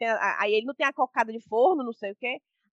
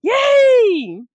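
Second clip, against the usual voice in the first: high, loud squealed cheer; gleeful kid cheer; 9 dB louder than usual. Retold or imitated. Imitating the children riding the pau de arara, gleeful squeal.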